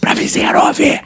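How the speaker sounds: real whisper so the neighbor won't hear. Real, not acted. Whispering so the neighbor next door can't hear. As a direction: hush it right down to a breath. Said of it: "Fala baixo, fala baixo".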